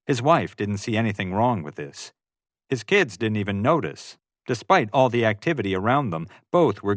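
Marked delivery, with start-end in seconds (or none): none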